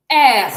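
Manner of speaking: loud R rolled from the throat; French throat R. Shown as a demonstration. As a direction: push the R out from the throat like clearing a cough. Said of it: "So not with your tongue".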